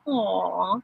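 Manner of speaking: ordinary delivery, nothing marked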